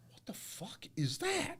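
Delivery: hushed, high, strained and slow; pained disbelief. Retold or imitated. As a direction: squeeze it out in quiet agony, retold. Acting out his shocked pain reaction.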